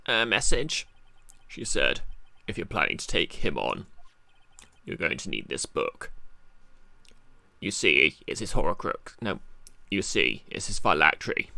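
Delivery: low, measured messenger voice; deep character voice. Acted. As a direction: deliver the message low and grave. Relays prosecutor's message.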